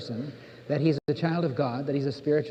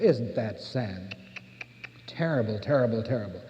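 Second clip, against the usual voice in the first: mock pity; 6 semitones lower, 4 dB quieter than usual. Mocking the sympathizer's lament.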